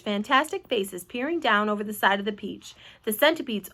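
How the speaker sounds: ordinary delivery, nothing marked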